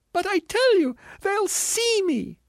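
very high, loud protest slowing to a wail; panicked ghost. A narrator's reading. Ghost insisting to the spirit, panicked wail.

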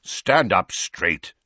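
slow, curt order; impatient. A narrator's reading. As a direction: bite it off with impatience. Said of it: "said Sirius impatiently".

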